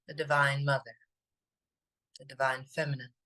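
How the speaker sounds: calm, unhurried meditation voice with long pauses; even, spacious delivery, letting each phrase settle; guided meditation. Acted. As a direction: lead listeners gently inward, phrase by phrase.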